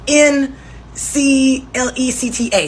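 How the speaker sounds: slow letter-by-letter spelling; spelling it wrong. Retold or imitated. He spells eclectic wrong, slow deliberate letters. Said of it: "He goes".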